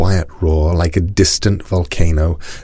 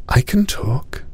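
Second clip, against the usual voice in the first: put-on high soft girl's voice; little girl voice; 7 semitones higher, 4 dB quieter than usual. A narrator's reading. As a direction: speak up shyly as a girl. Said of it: "said Cinnamon".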